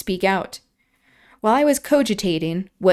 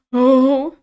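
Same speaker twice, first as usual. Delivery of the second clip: sobbed oh; 5 semitones higher, 6 dB louder than usual. A narrator's reading. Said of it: "he sobbed".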